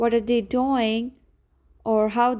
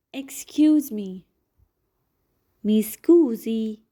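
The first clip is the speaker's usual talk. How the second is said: usual speaking voice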